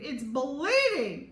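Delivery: slow, loud drawn-out cry; melodramatic wail. A narrator's reading. Chloe shows her finger to all, slow loud drama. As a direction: ham up the injury.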